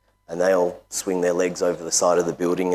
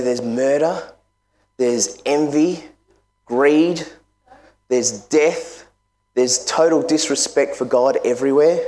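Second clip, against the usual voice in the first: rising, higher preaching list; 5 semitones higher than usual. Real, not acted. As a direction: build the fire, sin by sin. Preaching builds over a list of sins.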